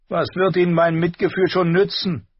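sulky prince. A narrator's reading. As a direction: whine it back.

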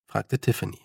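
ordinary delivery, nothing marked

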